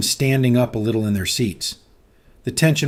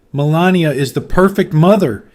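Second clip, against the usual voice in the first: loud, higher angry roar; 5 semitones higher, 6 dB louder than usual. A narrator's reading. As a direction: roar it in defence. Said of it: "he finally roared".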